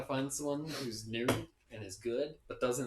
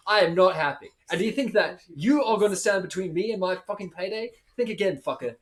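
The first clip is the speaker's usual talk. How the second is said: fed-up tirade building louder; angry rant; 6 semitones higher, 7 dB louder than usual. Acted. Fed-up adventurer tells off whoever blocks the payday, angry rant. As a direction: vent every grievance, hitting harder as it goes. Player's in-character rant at a foe.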